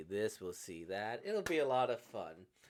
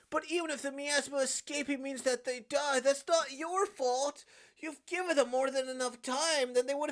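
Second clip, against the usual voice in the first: high, loud, impassioned cries; anguished, high-pitched emotional outpouring; desperate pleading; 10 semitones higher, 8 dB louder than usual. Acted. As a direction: plead at full voice, straining to hold on. Acted frantic plea as curse escapes.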